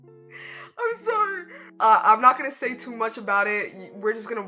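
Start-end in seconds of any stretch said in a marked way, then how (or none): none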